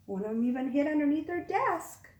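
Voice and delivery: ordinary delivery, nothing marked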